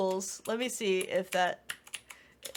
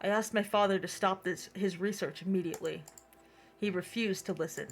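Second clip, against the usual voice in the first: story narration. Acted. Reads game narration aloud.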